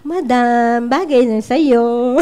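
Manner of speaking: slow, coaxing compliment; soft spoken sweet talk. Retold or imitated. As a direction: coax her gently and slowly. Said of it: "soft spoken".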